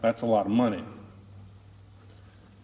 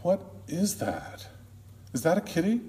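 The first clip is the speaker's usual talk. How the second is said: drowsy; 6 semitones higher, 4 dB quieter than usual. Retold or imitated. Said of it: "Carrie's drowsy voice says".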